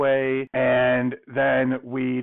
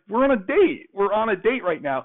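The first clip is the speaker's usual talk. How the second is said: high, pleading disbelief; insist, voice jumping up high; exasperated protest; 7 semitones higher, 3 dB louder than usual. Retold or imitated. Chris pleading with his date that they're on a date, exasperated protest.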